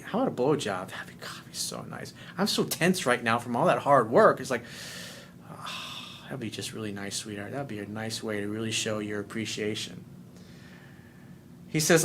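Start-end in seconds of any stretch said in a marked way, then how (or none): 0.0-10.0 soft seductive tease. Retold or imitated.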